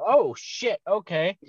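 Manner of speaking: high, taken-aback retold reply; mock alarmed "okay, okay" delivery; startled compliance. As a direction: act suddenly cowed, pitch jumping up. Startled son gives in.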